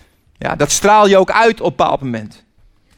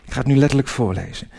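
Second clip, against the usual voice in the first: ordinary delivery, nothing marked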